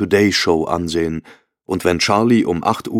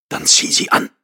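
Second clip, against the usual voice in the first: urgent whisper. A narrator's reading. Husband urging wife to hurry.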